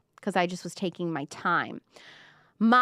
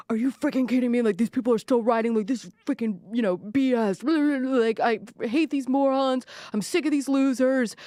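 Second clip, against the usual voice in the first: mock host rant; 2 semitones higher than usual. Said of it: "the private chats that these people have is like".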